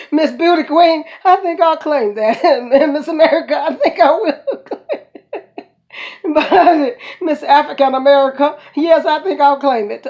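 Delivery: playful proud laughter; laughing. Real, not acted. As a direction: giggle through it, proud and amused. Laughing while claiming the titles.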